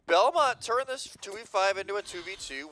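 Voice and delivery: loud, high disbelieving call; excited. Real, not acted. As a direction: yell in disbelief at the comeback. Caster yelling at co-caster.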